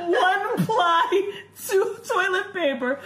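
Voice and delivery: slow, high, laughing almost crying; tearful laughter, barely getting words out; laughing hard. Real, not acted. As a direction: fight through helpless laughter. Laughing hard retelling the scene.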